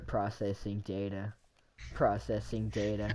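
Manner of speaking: robot voice. Pretend. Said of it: "Error, error, error".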